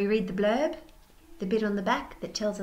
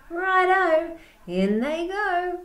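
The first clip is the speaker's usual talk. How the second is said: high, loud hammed-up cheer; gleeful dingo; 11 semitones higher, 6 dB louder, slower than usual. Dingo cheering as feathers go in, high and loud. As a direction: toss them in with glee.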